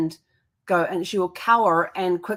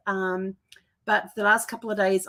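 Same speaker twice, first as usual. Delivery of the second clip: ordinary talk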